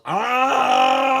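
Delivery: loud high yell; hippo yell. A narrator's reading. Hippo yelling a tune for Lucy. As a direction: bellow a big hippo shout. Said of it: "the hippo yelled".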